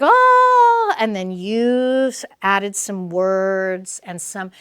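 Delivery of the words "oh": much higher, louder startled oh; a sudden yelp of surprise; surprised oh. Retold or imitated. The feeling realizing there is nothing to fight, surprised. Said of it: "The feeling is like".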